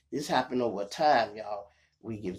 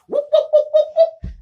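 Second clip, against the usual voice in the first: high loud siren whoops with a laugh; police siren; 13 dB louder, faster than usual. Retold or imitated. Mimicking the cop car siren behind him, high loud whoops.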